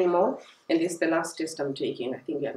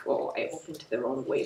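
ordinary talk, nothing marked

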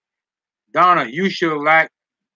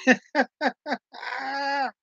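laughing; 9 semitones higher, 8 dB quieter than usual. Real, not acted. Laughs after "so it is true.

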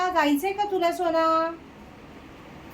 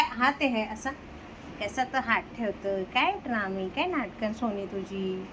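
soft amused grumble; 5 semitones lower, 7 dB quieter than usual. Real, not acted. Mother teasing the dramatic dog, soft low grumble. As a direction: tease the dog's drama under your breath.

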